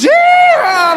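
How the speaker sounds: shrill high yell; a sudden hammed-up whoop; mock shout. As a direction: cheer it out, way up high.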